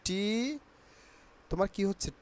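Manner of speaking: usual speaking voice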